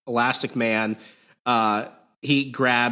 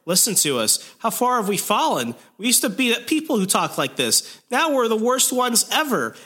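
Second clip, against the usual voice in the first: high-pitched, hammed-up lament; mock existential crisis; 9 semitones higher than usual. Zombie Spider-Man lamenting to the others, high mock anguish. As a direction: wail it theatrically, climbing higher as you go. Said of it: "having, like, an existential crisis".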